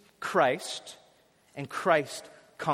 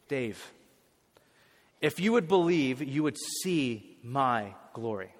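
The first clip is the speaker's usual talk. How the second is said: tender voice of God; 4 semitones lower, slower than usual. Acted. Voicing God speaking to him.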